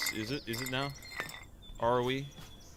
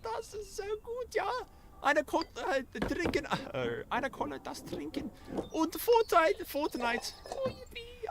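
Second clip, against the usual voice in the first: put-on German boy voice. Gamer switches on his German take, high hammed-up German accent. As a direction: ham up a cheery little German boy in a thick German accent. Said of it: "Turn it, turn on German take".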